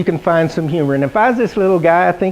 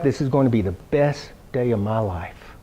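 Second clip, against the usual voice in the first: murmured to self; 5 semitones lower, 8 dB quieter than usual. Retold or imitated. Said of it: "I was saying to myself".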